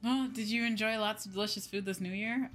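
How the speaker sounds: usual speaking voice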